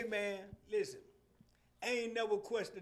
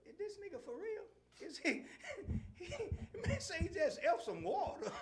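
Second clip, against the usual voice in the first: laughing disbelief; 9 semitones higher than usual. Retold or imitated. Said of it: "So I'm like".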